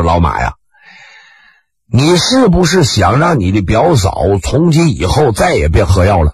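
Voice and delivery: low, sly adviser voice; sly advisor. Acted. Ji Xiaolan coaxing his friend, low and sly. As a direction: lean in low and knowing.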